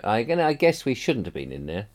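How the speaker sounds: usual speaking voice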